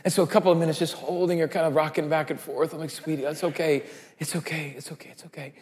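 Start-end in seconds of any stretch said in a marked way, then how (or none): 2.9-5.5 fading from soft to barely audible; soothing hush. Retold or imitated. Father rocking his daughter, whispering it's okay, fading out. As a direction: lull her calm, dying away.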